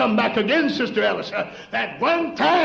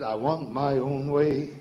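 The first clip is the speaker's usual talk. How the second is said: slow, lightly voiced stubborn inner self; a hint of a willful voice inside; 7 semitones lower, slower than usual. The self inside answers the hymn, lightly voiced stubborn. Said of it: "something back here said".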